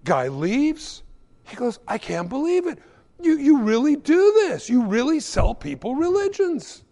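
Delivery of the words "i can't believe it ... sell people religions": high-pitched acted disbelief; astonished, pitched well up; incredulous. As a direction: play a customer who cannot believe this shop. Incredulous customer in acted skit.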